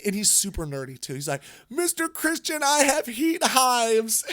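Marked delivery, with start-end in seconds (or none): 1.7-4.2 imitating a crying kid, higher and teary. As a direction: mimic the nerdy twelve-year-old on the verge of tears. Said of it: "and he's crying".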